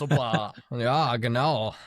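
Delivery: slow, mock German "Ja, genau"; German accent. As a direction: play up a German accent, drawn out. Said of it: "in the German accent now".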